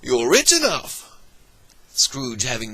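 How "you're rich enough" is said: much higher, quick and teasing; nephew's playful voice. Acted. Nephew teasing Scrooge with his own words, playful high voice. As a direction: toss his own words back at him cheerfully.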